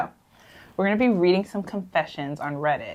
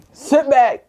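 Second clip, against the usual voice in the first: smooth radio host; 8 semitones higher, 10 dB louder than usual. Pretend. Redoing smooth host line.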